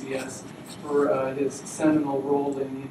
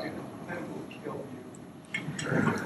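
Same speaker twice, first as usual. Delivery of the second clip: soft, sinister whispered threat; menacing whisper; 8 dB quieter, slower than usual. Acted. Jailer whispers the death threat to the prisoner, mock sinister. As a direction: drop to a creepy whisper on the threat.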